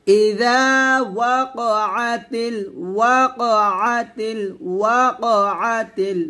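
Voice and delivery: ওয়াকআতিল said with the ain stop, not ওয়াকা; correct pronunciation demo. Shown as a demonstration. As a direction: stress the ain in ওয়াকআতিল clearly. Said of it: "ওয়াকা হবে না". (ওয়াকআতিল আইন স্পষ্ট করে)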